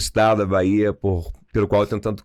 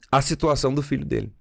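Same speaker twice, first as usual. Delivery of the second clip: normal talking voice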